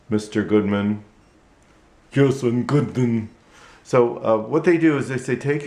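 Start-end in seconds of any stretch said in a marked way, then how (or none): 2.1-3.3 puppet popping up to greet. Acted. Puppet answers.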